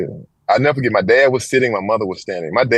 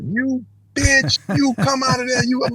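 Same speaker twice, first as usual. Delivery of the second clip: much higher imitated screech at the neighbour; mock cursing; slower than usual. Mother curses out the real mother next door, high imitated screech. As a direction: yell it across the yard. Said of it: "I could hear her cursing out".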